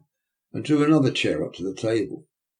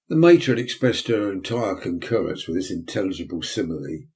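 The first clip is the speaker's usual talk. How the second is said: plain reading voice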